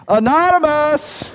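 put-on high, loud call for a lost child. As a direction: shout the name out into the distance. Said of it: "I called out".

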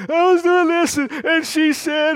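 put-on high, loud whiny child voice; child's voice. Father quoting his child to the congregation in a high whiny child voice.

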